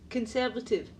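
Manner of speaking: ordinary delivery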